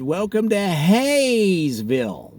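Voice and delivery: Hayesville said slowly with HAY leaned on; slow sign-reading with HAY in Hayesville hit hard; stress on hay. Shown as a demonstration. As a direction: draw out "Welcome to Hayesville", punching the hay. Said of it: "with the emphasis on the hay".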